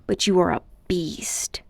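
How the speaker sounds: hissed under breath; boy muttering his insult at the uncle, whispered. A narrator's reading. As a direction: whisper it with contempt.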